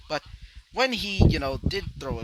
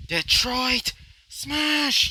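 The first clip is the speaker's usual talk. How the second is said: long, high, loud attack call; screamed out; 10 semitones higher, 6 dB louder, much slower than usual. Acted. Hero calling his punch, long screamed battle cry. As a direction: draw out each word in a big yell. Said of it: "he would scream out".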